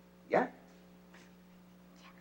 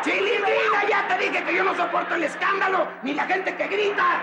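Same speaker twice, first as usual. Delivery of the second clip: very high-pitched exasperated yell; shrieking scolding; furious outburst; 12 semitones higher than usual. Acted. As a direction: explode at the noisy child. Father loses temper at bawling daughter.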